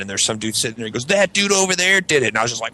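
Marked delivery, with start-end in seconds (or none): 1.1-2.3 accusing bystander. Retold or imitated.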